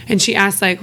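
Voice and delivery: normal talking voice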